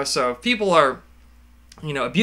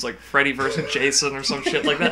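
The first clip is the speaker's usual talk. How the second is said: ordinary talk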